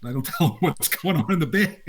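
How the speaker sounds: normal talking voice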